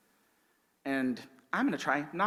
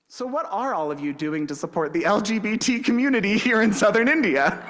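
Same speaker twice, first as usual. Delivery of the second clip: higher, louder, eager Linda voice; Linda's question; 7 semitones higher, 6 dB louder than usual. Retold or imitated. Linda asks the pastors at the gathering, higher and louder. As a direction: pipe up brightly with the question. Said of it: "and Linda goes".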